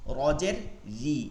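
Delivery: ordinary delivery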